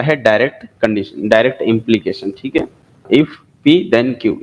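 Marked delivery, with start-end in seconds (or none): 3.1-4.4 very slow, word by word; slow reading aloud. Shown as a demonstration. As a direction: spell out each word of the formula, very slowly. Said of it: "इसको इस तरह से read किया जाता है".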